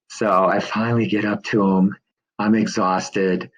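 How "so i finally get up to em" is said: ordinary delivery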